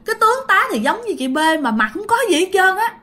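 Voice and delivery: high, agitated voice of a scared witness; acted fright, high and insistent; frightened retelling. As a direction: blurt out the scare, pitched well up. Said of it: "cho nên dì rất là sợ".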